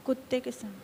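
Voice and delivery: very soft, barely projected reading; too quiet. Real, not acted. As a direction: read it out far too softly. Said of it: "फिर से बोल जोर से". (बहुत धीमी आवाज़ में पढ़ना)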